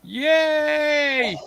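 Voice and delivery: bright high cheer of delight; excited cheer. Real, not acted. Cheer before the main attraction.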